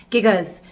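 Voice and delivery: "Gigas" with hard g's, firmly falling; GIGAS pronunciation. Shown as a demonstration. Plant owner settling on a pronunciation, "Gigas" with hard g's. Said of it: "I think it's Gigas 'cause big".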